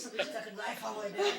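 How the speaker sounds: rushed. Real, not acted. Sore throat from the vapour.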